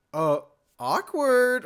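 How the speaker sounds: high, slow, sarcastic drawn-out awkward; mock a cringey movie quip. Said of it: "always hate, like".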